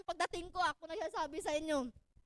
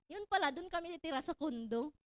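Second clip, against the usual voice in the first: ordinary talk, nothing marked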